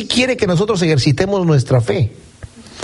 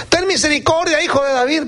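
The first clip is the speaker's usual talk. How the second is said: mock cry for mercy; 9 semitones higher than usual. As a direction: imitate blind men calling out from behind. (súplica a gritos imitada, mucho más aguda)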